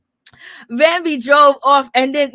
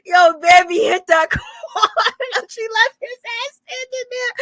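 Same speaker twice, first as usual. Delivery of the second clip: laughing; 9 semitones higher than usual. Real, not acted. Cracking up at Bambi driving off.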